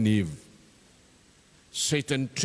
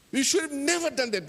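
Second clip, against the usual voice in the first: much higher, heated repeat of the reproach; rising anger; 10 semitones higher than usual. Acted. Chope blaming Tabale again, rising to a heated scold. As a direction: push the blame harder, voice climbing.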